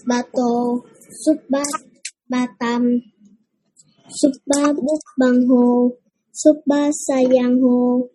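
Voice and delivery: usual speaking voice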